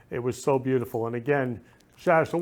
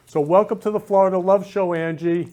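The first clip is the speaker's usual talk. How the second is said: louder, higher show-host welcome; excited welcome; 5 semitones higher, 5 dB louder than usual. Acted. Host welcoming guest onto his show, louder and excited. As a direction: greet her like a host.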